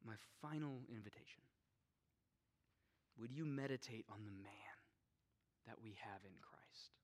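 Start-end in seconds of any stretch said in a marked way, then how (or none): 3.2-6.9 hushed whispered question; whispering. Real, not acted. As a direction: whisper it softly. Whispered invitation.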